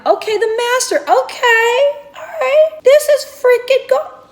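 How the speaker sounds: touring the master bedroom, squealing with delight; excited squeal. Real, not acted. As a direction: react like the room just wowed you. First look at the master bedroom.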